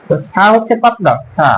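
normal talking voice

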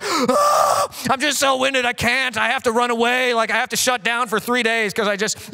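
dad dodging an emotional talk, hammed-up flustered voice. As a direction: play a dad panicking to escape a feelings talk. Dad avoiding feelings.

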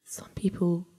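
hushed, secretive whisper; conspiratorial whisper. Acted. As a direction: lean in and share a secret.

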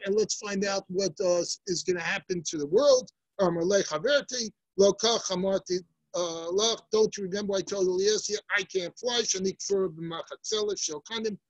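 ordinary talk, nothing marked

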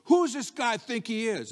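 indignant challenge, pitched high; angry David. Acted. Preacher voicing David hearing Goliath mock God, angry. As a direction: fire it off with scornful indignation. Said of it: "And David gets angry".

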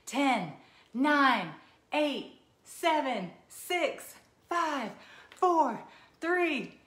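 loud, high excited countdown; new year countdown crowd. Pretend. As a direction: shout the countdown to midnight, building. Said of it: "Let's count down from ten".

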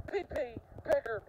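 ordinary talk

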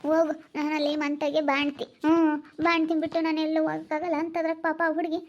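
usual speaking voice

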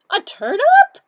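high, loud silly duck; squeaky duck voice. Acted. Duck's line in story.

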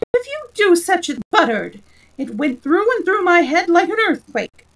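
loud, high outburst; indignant scolding. A narrator's reading. Deafened king scolding the shouting messenger, indignant. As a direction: protest hotly, pitch up throughout. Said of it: "cried the poor king".

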